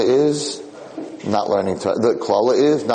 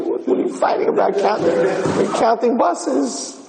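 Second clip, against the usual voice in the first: mock incredulous; 11 semitones higher than usual. Visitor asking why they fight over counting buses, mock incredulous. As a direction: question the squabble in a high, disbelieving mock voice. Retelling the bewildered visitor's question.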